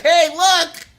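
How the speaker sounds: high, loud goofy call; mocking classmate. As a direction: mimic the kid showing off. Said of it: "but he'd be like".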